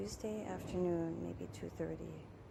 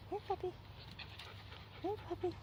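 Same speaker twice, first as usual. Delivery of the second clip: calling a puppy; 10 semitones higher, 6 dB quieter, much faster than usual. Real, not acted.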